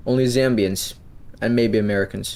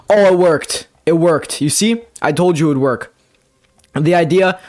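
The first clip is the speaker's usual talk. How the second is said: higher, louder burst of joy; too excited; 5 semitones higher, 4 dB louder than usual. Real, not acted. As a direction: cheer it out, thrilled it worked. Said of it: "sorry, I'm getting too excited".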